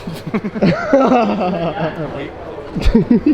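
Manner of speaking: high, loud laughter; a giggling fit. Real, not acted. Laughing at friends.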